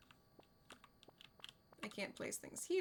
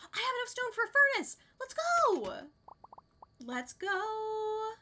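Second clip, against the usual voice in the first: high, fast, loud cheer of triumph; excited cheer; 11 semitones higher, 6 dB louder than usual. Real, not acted. Streamer cheering to chat after finding enough stone, excited high shout. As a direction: shout it out, thrilled to finally have enough.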